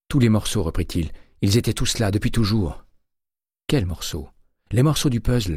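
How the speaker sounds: the pieces are clicking into place; eager excitement. A narrator's reading. Terry explaining the puzzle to Winston, excited.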